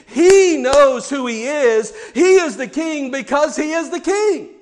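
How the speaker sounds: loud, high, building sermon climax; full-force preaching. Acted. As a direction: preach it with rising fire.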